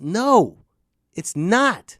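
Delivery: usual speaking voice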